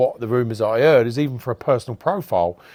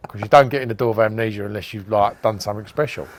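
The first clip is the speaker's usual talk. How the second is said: ordinary talk, nothing marked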